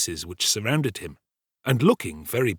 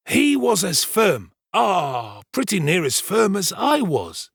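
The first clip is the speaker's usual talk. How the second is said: higher, deliberate pompous servant voice; self-important storyteller Giles; 10 semitones higher, 4 dB louder, slower than usual. Acted. Servant retelling the night's alarm to the kitchen, pompous storytelling. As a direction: hold forth slowly, relishing the tale.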